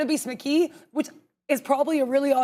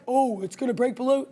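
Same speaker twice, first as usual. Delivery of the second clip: lightly put-on bear character voice; 2 semitones lower than usual. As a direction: play your inner bear, only slightly off your own voice. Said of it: "I don't know why I keep doing that voice".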